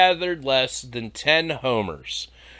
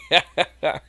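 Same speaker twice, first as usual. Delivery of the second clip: ordinary talk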